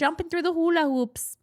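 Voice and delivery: put-on Wisconsin accent. Quoting mother-in-law about the dogs, thick Wisconsin accent. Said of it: "she has a Wisconsin accent".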